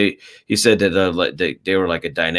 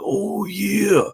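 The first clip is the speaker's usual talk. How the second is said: high, gravelly-free put-on wrestler growl pitched way up; Macho Man impression; 13 semitones higher than usual. Friend imitating Macho Man's catchphrase for a buddy, pitched way up. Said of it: "like, the Macho, like".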